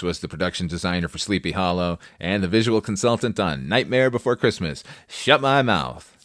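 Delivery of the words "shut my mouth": ordinary talk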